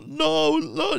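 high, plaintive bewildered whine; whiny Mike. Retold or imitated. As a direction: squeak it out, baffled and pleading. Mike denying in story.

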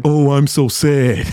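slow, drawn-out whiny sadness; mock self-pity. Host imitating a friend wanting pity, mock sad. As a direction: moan it like you want pity. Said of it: "you sound like you giving up".

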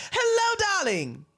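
hello swooped very high, darling dropped; a grand sing-song hello, darling; Andre Leon Talley impression. Storyteller voices Andre waving hello around town, swooping high. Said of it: "you just wave".

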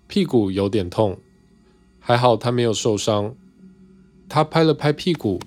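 ordinary delivery, nothing marked

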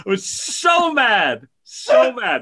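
ordinary talk, nothing marked

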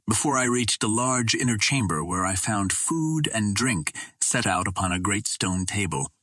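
ordinary delivery

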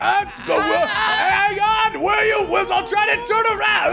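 high shouting voice. Acted. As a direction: call back across the distance. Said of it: "Tab goes".

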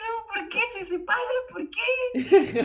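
imitating her own teary protest; mock distress. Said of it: "yo lloraba y digo". (lamento exagerado, medio en broma)

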